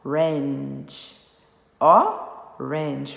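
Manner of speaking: orange split as o... range, with a silent final e; "orange" in two clear chunks, ending on the consonant; two separate syllables. Shown as a demonstration. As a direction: break orange into two slow beats, no vowel at the end. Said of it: "a gente não falou é no fim".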